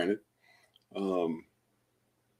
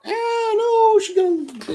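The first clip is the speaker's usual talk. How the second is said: much higher startled squeak, louder; startled squeak at the cat; squeaky alarm; 6 dB louder, slower than usual. Real, not acted. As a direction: yelp up high as the cat moves. Reacting to cat.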